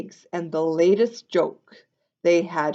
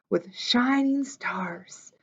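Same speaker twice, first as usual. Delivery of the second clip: breathy hushed whisper; whispered like a little secret; 3 semitones higher, 5 dB quieter, slower than usual. A narrator's reading. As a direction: drop to a slow secret whisper. Whispered detail in story reading.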